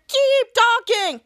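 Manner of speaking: high, drawn-out order; hero's mock command. Hero addressing texting kids, high drawn-out voice. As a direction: stretch it out, pitched high. Acted hero's quote in recap.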